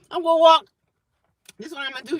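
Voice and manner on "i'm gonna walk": excited. Real, not acted. Excited plan, loud high.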